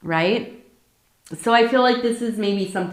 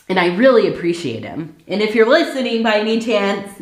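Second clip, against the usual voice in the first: ordinary talk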